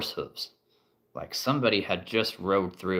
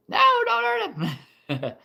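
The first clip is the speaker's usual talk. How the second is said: high squeaky cry to spare him; panicked plea; 6 dB louder than usual. Acted. Game master begging players not to hurt him, squeaky high. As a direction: shriek it out to stop the attack.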